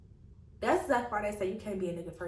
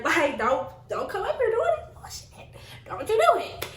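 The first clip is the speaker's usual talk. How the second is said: high-pitched, louder "don't you dare"; exasperated warning; 11 semitones higher, 5 dB louder than usual. Retold or imitated. Woman warning a man off her job, high exasperated warning. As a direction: plead and scold, pitch leaping way up. Warning him off in retold exchange.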